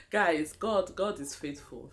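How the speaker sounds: usual speaking voice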